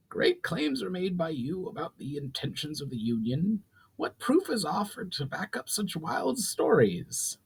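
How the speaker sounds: a proud goblin lord laying down terms; goblin chief Brawl. Acted. Brawl questions the elf.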